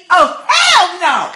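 outraged. Real, not acted. Outraged reaction to scene.

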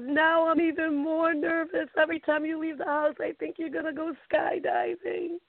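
anxious mother fearing her daughter will sneak off skydiving; nervous mom. Retold or imitated.

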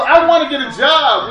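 high and loud, laughing; with energy. Shown as a demonstration. Teacher pushes the class for energy, loud and high. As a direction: pump energy into it. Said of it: "Get some energy, people".

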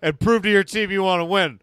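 loud drill-sergeant orders; coach yelling. Pretend. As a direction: bark it at the players.

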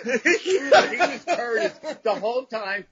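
can't hold the laugh while telling the punchline; slow, laughing reveal. Real, not acted.

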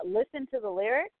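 normal talking voice

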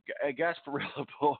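ordinary talk, nothing marked

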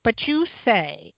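ordinary talk

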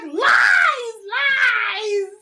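high, loud shrill shouted accusation; shrill [name]. Retold or imitated. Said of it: "Good impression".

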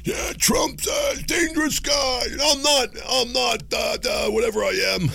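very high, slow, hammed-up shouting Biden; mock Biden. Host mocks Biden warning about Trump, very high and shouted. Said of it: "Biden comes out, very".

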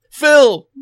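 name belted out high and loud; loud excited call. Real, not acted. Host hyping the next guest, loud excited call. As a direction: shout the name like a game-show host.

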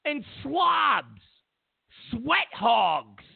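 heated rant. Real, not acted. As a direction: rant at them, high and heated. Angry ranting at reporters.

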